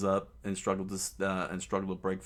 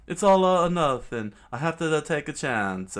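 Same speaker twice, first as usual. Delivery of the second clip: loud, higher resolve fading down; all or nothing; 7 semitones higher, 8 dB louder than usual. Acted. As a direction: shout your courage, then settle. Chopper steeling himself.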